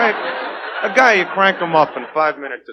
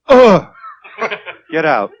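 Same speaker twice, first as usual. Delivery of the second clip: one-night stand impression; slower than usual. Waking next to a one-night stand, disgusted groan then dismissal. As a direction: groan in disgust and kick them out. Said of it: "Here's my impression of a one-night stand".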